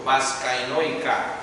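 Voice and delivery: usual speaking voice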